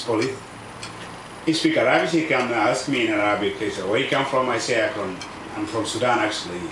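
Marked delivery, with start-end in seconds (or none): none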